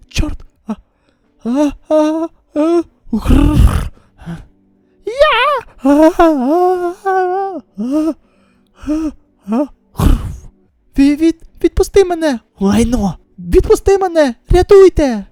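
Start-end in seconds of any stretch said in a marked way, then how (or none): 1.4-9.7 loud grunting then growl; strained struggle growl. Acted. 11.0-15.2 loud, high desperate pleading; panicked cries for help. Acted. Seized, crying for help.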